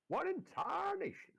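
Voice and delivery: high, slow Southern exclamation; Southern accent. Acted. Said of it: "do a Southern accent".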